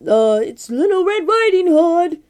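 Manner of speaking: loud, slow put-on girl voice by the wolf; wolf pretending to be the sweet girl, hammed up; big bad wolf faking a little girl's voice. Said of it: "Wow, that's a good impression".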